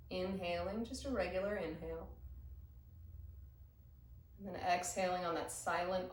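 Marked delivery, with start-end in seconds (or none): none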